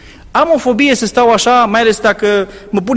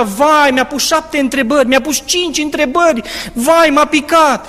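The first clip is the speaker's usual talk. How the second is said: higher, whining complaints; mock-whining students; 7 semitones higher than usual. Students leaving the oral, in a high mock whine. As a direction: imitate the students coming out. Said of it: "ieșea".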